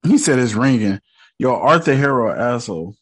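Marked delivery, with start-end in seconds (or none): none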